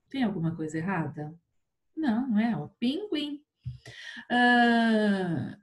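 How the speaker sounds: normal talking voice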